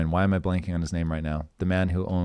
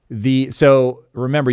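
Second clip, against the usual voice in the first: normal talking voice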